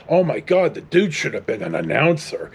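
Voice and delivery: ordinary delivery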